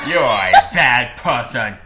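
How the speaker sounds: loud mock-judge moderator voice; Discord moderator voice. Mock judge scolds the condemned, Discord moderator voice. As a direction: scold in a booming put-on moderator voice. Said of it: "do the Discord moderator voice".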